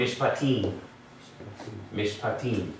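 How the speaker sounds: Mishpatim as mish-pa-TEEM, long final vowel; stress on last syllable. Shown as a demonstration. Teacher models the word for class, final stress on Mishpatim. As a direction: lean on the "tim" of Mishpatim. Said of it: "the last syllable gets the accent".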